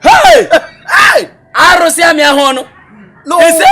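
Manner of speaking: loud, high cries of astonishment; shocked exclaiming. Acted. Friend hearing a secret pregnancy revealed, shocked exclaiming. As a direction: cry out at scandalous news, loud and high.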